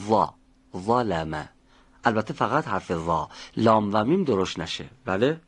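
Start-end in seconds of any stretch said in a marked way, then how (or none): 0.1-1.5 ظا and ظلم with a thick, emphatic ظ; heavy ظ. Shown as a demonstration. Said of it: "درشتم باید بگید".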